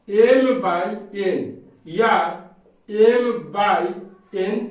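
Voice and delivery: word by word, long pauses between each word; slow dictation. Acted. Teacher dictating a definition to students, slow and spaced. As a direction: spell it out slowly for students to copy.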